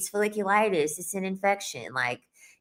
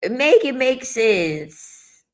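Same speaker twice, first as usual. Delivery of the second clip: "Make it make sense" stretched out slowly, each word weighed; slow emphatic; 5 semitones higher, 4 dB louder, much slower than usual. Shown as a demonstration. Exasperated at men's logic, slow and deliberate.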